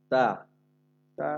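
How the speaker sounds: তা said light and thin, from the tip of the tongue; a light, thin তা, no weight on it; thin ta sound. Shown as a demonstration. Teacher showing students the thin তা letter sound. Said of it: "পাতলা আওয়াজে".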